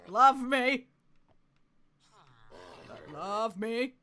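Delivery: loud, high mock-demanding; playful demand. Streamer taming a horse, loudly demanding love.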